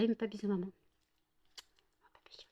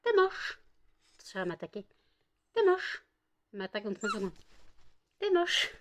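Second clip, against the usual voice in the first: playful teasing. Real, not acted. Teasing the dog.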